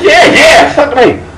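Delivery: eager yes. Retold or imitated. The narrator eagerly accepting Twinkle's offer, laughing. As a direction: jump at the offer, grinning.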